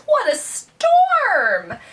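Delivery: high, loud, drawn-out bird voice; twittering wren. Acted. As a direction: exclaim like an excited little wren, stretching the words. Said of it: "twitters wren".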